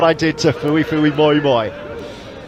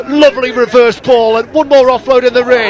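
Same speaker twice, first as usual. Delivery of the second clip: excited; 7 semitones higher, 7 dB louder than usual. Real, not acted. Calling a near try.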